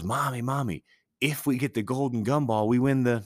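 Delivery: lightly voiced kid bursting with excitement; excited kid. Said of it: "all excited".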